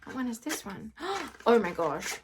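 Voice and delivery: ordinary talk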